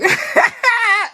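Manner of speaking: high-pitched burst of laughter; cackling at the joke; squealing laugh. Real, not acted. Laughing at own dirty joke.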